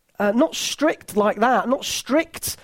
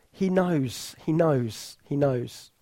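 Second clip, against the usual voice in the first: tender fading reassurance; 7 semitones lower, 5 dB quieter, slower than usual. Real, not acted. Comforting the bereaved.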